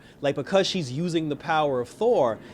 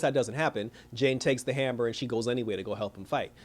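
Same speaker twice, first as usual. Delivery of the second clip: normal talking voice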